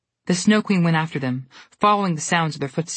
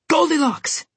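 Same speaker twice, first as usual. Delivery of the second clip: scream; 11 semitones higher, slower than usual. A narrator's reading. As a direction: cry out in alarm, pitched way up. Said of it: "Jack screamed".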